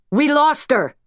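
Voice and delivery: a medic calling out the loss; yelled. A narrator's reading. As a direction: shout it across the room. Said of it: "yells, really".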